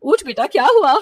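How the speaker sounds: loud, urgent waking call; anxious mother calling out; worried mother. Acted. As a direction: rouse your daughter from a nightmare. Mother waking frightened daughter.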